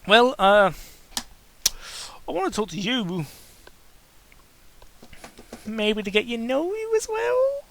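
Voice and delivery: a hint of a high timid voice; lightly voiced character. Narrator voicing the shy hero to the pony. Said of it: "What voice I did".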